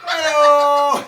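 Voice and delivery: loud, drawn-out "hey-o"; punchline catchphrase. Acted. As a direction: call it out big to cap the joke. Comedian's shouted punchline catchphrase.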